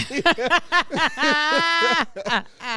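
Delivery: a burst of high-pitched laughter; loud high laugh. Real, not acted. Laughing at a friend calling his wife fine, loud high laugh. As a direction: crack up loudly.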